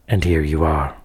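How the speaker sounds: low and loud, savouring the trap; villain gloats as the detective walks into his trap, low and menacing; gloating menace. Acted.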